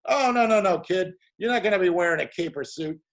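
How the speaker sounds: put-on calm the kid's worries cheerfully; retelling the director soothing the young actor; reassuring director. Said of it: "He goes".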